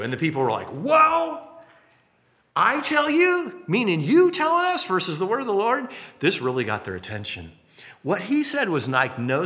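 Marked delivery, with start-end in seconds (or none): 0.7-1.4 high, loud, drawn crowd gasp of whoa; astonished whoa. Acted. Congregation reacting to Jesus's bold claim, astonished. 2.5-5.9 high, stretched, disbelieving questioning; incredulous crowd. Acted. Crowd challenging who Jesus is to say this, incredulous.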